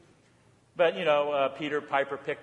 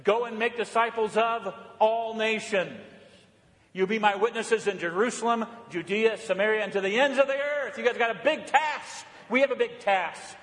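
emphatic, raised-pitch charge; fervent preaching; 6 semitones higher, 4 dB louder than usual. Real, not acted. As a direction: send the congregation out on a mission. Preaches the commission with fervour.

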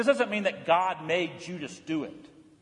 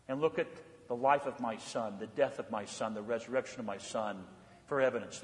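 softer, lower, gentle declaration of love; tender reassurance; 3 semitones lower, 4 dB quieter than usual. Acted. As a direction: speak as a loving God comforting his people. Voices God's tender declaration of love.

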